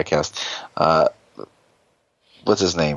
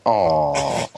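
drawn-out mock sad "aw"; sad sigh; 3 semitones lower than usual. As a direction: let out a low, dejected "aw". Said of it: "he goes away sad".

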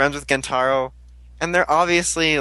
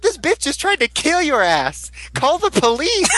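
mock outrage at the hug, shouting; outraged shout; 12 semitones higher than usual. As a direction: yell it in disbelief.

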